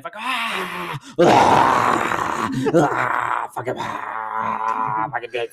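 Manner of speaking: strangled, drawn-out ranting; her raving voice. Retold or imitated. Imitating a raging inmate's cursing, strangled rant. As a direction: rave and curse like she's lost it. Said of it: "That's exactly what she fucking sounds like".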